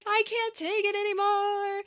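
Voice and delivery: distraught mother. Retold or imitated. Mother telling her sons she's done, slow mock despair. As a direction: drag it out like a worn-out mother. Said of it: "And she's like".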